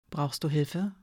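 very soft, low, gentle question. A narrator's reading. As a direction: ask as softly as you can. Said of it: "ihre Stimme dabei so sanft klingen lassen". (sanft und behutsam)

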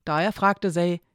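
plain reading voice

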